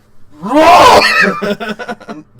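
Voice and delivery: big, high burst of laughter that breaks into a shout; loud laughing whoop of delight; loud real laugh. Real, not acted. Laughing at horse throw scene.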